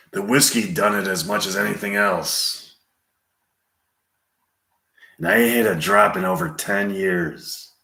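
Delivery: quiet Western gunman impression; Clint Eastwood. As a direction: confide a hard past, with long pauses. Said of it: "Clint Eastwood quietly talking about his life".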